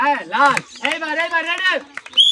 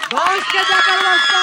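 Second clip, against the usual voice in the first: slow, raised game-show reveal; host revealing the prize to the crowd, slow and raised; prize announcement; 5 semitones higher, 4 dB louder, slower than usual. Acted. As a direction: unveil the prize like a showman.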